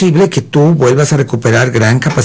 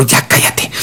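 hushed retort; 3 dB quieter, slower than usual. Retold or imitated. Snapping back at heckler.

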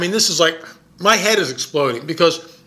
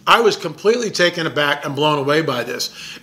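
normal talking voice

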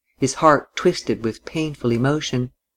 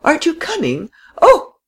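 much higher, rising to a loud Oh; frightened cry; 3 dB louder than usual. A narrator's reading. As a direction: panic as the tram leaves. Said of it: "cried the frail, excitable little lady".

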